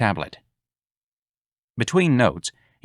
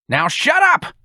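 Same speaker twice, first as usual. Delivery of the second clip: shrill scream, much higher and louder; 5 dB louder, slower than usual. Acted. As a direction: shout him down. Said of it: "Mister Clyde screamed".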